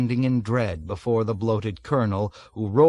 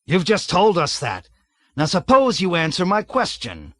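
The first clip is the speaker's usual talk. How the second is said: loud, higher barked rebuke; angry shout; 6 semitones higher, 4 dB louder than usual. A narrator's reading. Colonel shouts down Major Metcalf angrily. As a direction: tear into him.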